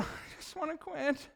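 a hint of a soft, whiny giving-up voice; Snavely voice. Pastor voices the quitting feeling, lightly whiny. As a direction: lightly sigh out the wish to quit. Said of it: "the Snavely voice comes out".

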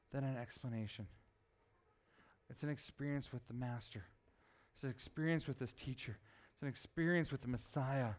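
low, soft, reflective sermon close; hushed preaching. Real, not acted. Preacher assuring the congregation they never weep alone, hushed. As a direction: bring it right down, quiet and reverent.

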